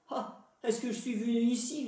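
proud, scornful sneer; haughty brute. A narrator's reading. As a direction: look down on the old woman. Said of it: "cette brutale orgueilleuse".

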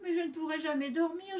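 high-pitched, lightly voiced whine; a touch of piglet groaning; moaning complaint. A narrator's reading. Doudou objecting to his brother's plan, moaning lightly. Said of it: "gémit Doudou".

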